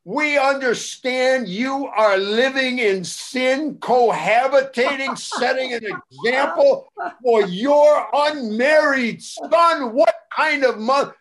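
high, slow, booming condemnation as a prank; hammed-up moral denunciation; mock scandalized official. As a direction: draw out each accusation in pompous outrage. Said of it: "I forget who he said he was".

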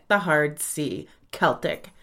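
Celtic with a hard K sound. Shown as a demonstration. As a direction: say Celtic as KEL-tik. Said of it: "the proper way to pronounce it is".

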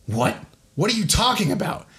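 mildly indignant outburst, jumping high in pitch; worked up. Acted. Harvey turns on the whispering man, worked up. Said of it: "gets so worked up".

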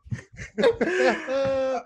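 high, loud burst of laughter; a real, giddy laugh of delight. Real, not acted. Delighted by unicorn handkerchiefs.

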